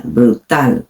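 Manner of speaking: "brutal" low, with a crisp T and stressed -tal; sounded Spanish T. Shown as a demonstration. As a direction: make the T ring in "brutal". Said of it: "La T suena".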